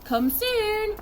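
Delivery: high-pitched farewell call; shouting. A narrator's reading. Family waves them off, calling out. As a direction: call out after a departing boat. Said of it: "they shouted".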